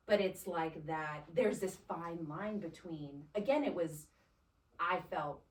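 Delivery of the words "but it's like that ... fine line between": ordinary talk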